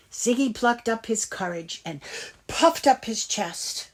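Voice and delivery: ordinary delivery, nothing marked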